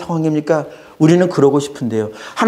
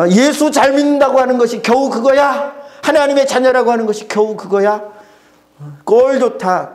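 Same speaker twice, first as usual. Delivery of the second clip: higher scornful taunt; mocking jeer; 6 semitones higher than usual. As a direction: scoff at the believer. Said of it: "손가락질하고 비웃습니다". (비웃는 조롱조)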